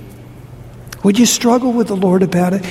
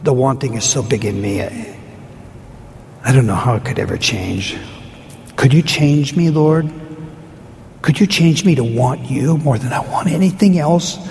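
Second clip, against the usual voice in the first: lower, pleading prayer voice; humble prayer; 4 semitones lower than usual. Real, not acted. Pastor praying honestly before the congregation, lower and pleading.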